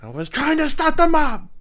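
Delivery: high, strained movie-character outburst; Gary Oldman. Acted. Player doing his actor impression for his friend, high and hammed-up. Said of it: "That's my, my Gary Oldman".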